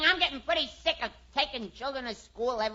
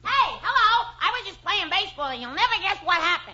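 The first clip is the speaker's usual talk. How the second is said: high, bright rush of news; excited kid; 7 semitones higher than usual. Acted. Herbie bursting home to tell Dad about baseball.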